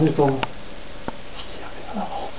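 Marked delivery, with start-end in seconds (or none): 1.3-2.3 hushed amazed whisper. Acted. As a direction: whisper it in disbelief.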